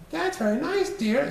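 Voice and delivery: wife answering her husband; mother's voice. Acted. Missus Popsnuggle replies.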